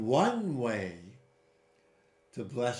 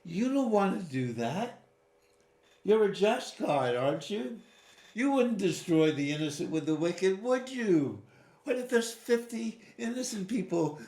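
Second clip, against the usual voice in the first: higher, earnest bargaining plea; pleading Abraham; 7 semitones higher than usual. Retold or imitated. Abraham pleading with God to spare the innocent, higher and earnest. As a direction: coax him like a lawyer pressing a case. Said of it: "And Abraham says".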